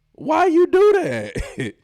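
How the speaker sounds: high, loud, slow disbelief; can't believe it; incredulous. Real, not acted. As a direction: drag it out in disbelief. Incredulous commentator.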